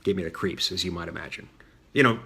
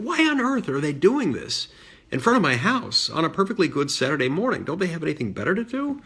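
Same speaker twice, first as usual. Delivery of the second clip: higher-pitched, exasperated disbelief; indignant; 6 semitones higher than usual. Retold or imitated. Boy thinking about protesters at his house, indignant. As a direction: protest as an affronted child would.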